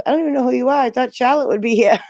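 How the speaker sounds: high, puzzled retold voice; lightly voiced confused kid; confused young guy. Imitating James.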